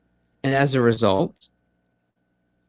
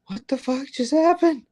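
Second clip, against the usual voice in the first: high, incredulous put-on voice; mock stunned; 13 semitones higher than usual. Host imitating a baffled listener, mock shock. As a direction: play the baffled bystander.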